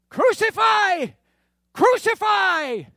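high, slow shouted demand; crowd shout. Acted. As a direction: bellow it like an angry mob.

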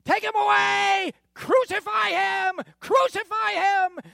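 high, slow roaring chant; shouted demand. Acted. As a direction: roar it like a furious mob. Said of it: "And they shouted".

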